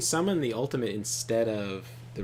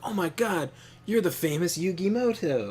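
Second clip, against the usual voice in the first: starstruck fan; 7 semitones higher, 4 dB louder than usual. Retold or imitated. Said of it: "he's like".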